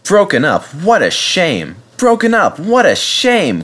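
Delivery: high, rhythmic, clanking lament; distressed engine repeating in rhythm. A narrator's reading. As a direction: chant it like clanking machinery, dragging out the shame. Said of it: "clanked Edward as he went".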